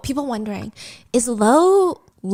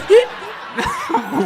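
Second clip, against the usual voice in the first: laughing; 10 semitones higher than usual. Real, not acted. Laughing at husband's account.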